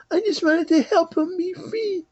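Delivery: put-on a child defending herself; little girl replying to the old man, whining.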